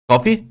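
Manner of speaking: "Coffee?" with a sharp high rise; rising question. Shown as a demonstration. Teacher showing students how to offer coffee, rising tune on "Coffee?". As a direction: ask "Coffee?" with the pitch going up. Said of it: "Bak şöyle yap".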